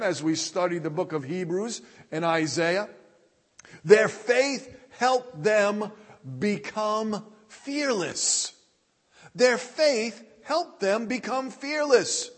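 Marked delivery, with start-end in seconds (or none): 3.8-8.5 very slow, with pauses between phrases; slow, deliberate. Real, not acted. Preacher drives home the main point to the congregation, slow and deliberate. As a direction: spell out the key point slowly, word group by word group. Key point stated slowly, then repeated.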